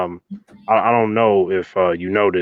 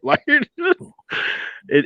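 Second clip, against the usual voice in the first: ordinary talk, nothing marked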